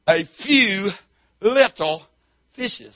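drawn-out, halting belittlement of the catch; belittling. Acted. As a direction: make it sound pitifully small, word by word. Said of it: "didn't have to say it like that".

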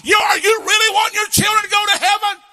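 high, loud shouted sermon; full-force preaching. Acted. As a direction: thunder the rebuke at the parents. Preaching climax.

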